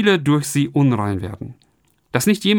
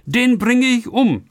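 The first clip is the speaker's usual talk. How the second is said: vengeful threat; 7 semitones higher than usual. Acted. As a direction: spit out a brother's murder vow. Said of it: "Das ist der Gedanke Esaus". (Esau, rachsüchtig drohend, langsam und laut)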